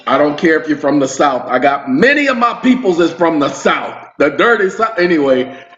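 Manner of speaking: ordinary talk, nothing marked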